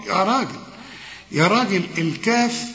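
usual speaking voice

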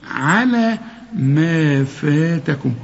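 على ما فاتكم drawn out, the long vowels held; very slow recitation. Shown as a demonstration. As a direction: model the verse very slowly. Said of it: "الفيل اللي كنا عمالين نقول".

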